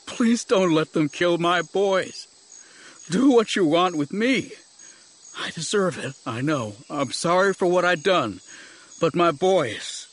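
sobbing plea. A narrator's reading. Father begging Jedi for his boys' lives, sobbing. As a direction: beg desperately, stretching the last words. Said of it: "he sobbed, face down".

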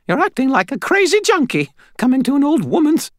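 high, squeaky, scolding demand; querulous old lady. A narrator's reading. Old lady to intruder while dialling, squeaky scolding. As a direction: berate him in a squeaky old-woman voice.

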